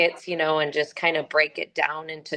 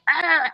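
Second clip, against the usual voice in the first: mock frustrated yell; 12 semitones higher than usual. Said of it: "he's like".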